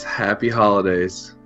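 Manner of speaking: ordinary talk, nothing marked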